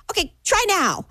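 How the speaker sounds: high-pitched voice of office staff; imitated intercom attendant. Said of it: "through the intercom, she goes".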